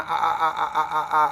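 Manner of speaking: ordinary talk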